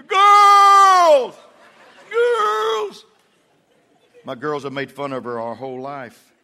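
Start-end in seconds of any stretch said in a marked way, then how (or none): 0.1-1.4 very loud, high full-throated holler; wide-mouthed yell. Retold or imitated. Husband showing wife how to call girls, huge yell. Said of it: "open your mouth wide and say". 2.1-3.0 very high mock yell; feeble falsetto call. Wife trying again to call girls, high feeble yell.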